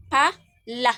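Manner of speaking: "Pala" broken into loud pa, then la; accented delivery. Shown as a demonstration. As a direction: punch "Pala" as two separate syllables. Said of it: "na dyan kay pinadiin".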